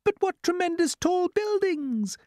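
very high, slow ladybird voice full of wonder; exclaiming ladybird. Acted. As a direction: marvel in a high character voice. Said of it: "exclaimed the ladybird".

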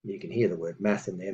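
usual speaking voice

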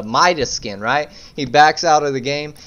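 usual speaking voice

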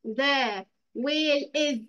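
"ذا ويل إز" said slowly with إز landed firmly; stress on is. Shown as a demonstration. Teacher showing the class not to forget is, stressing إز. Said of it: "مننساش إز".

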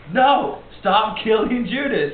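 loud, high, drawn-out objection; mock protesting cry. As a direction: order them to stop, hammed up. Acted Jesus protesting again.

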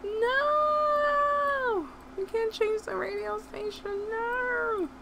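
high, loud panicked cry; frantic shout. Real, not acted. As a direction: yell it in real panic. Panics at the music.

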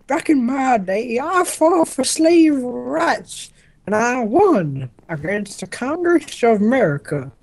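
put-on high, slow old man voice; creaky old-timer telling war stories; Abraham Lincoln. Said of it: "I'm Abraham Lincoln".

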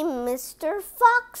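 ordinary delivery

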